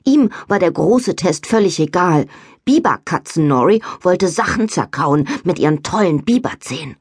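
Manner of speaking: ordinary delivery, nothing marked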